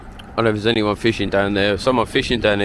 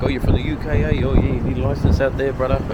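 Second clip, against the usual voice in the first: a light imitation of a friendly fisherman, higher pitch; hint of a laid-back local voice, a little quicker; islander fisherman; 4 semitones higher than usual. Fisherman telling him about UK licenses, lightly imitated. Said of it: "He's like".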